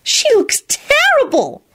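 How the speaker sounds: high and slow, delighted; gleeful high whisper. A narrator's reading. Solange gloating to Elizabeth, high slow delight. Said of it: "Solange whispered happily".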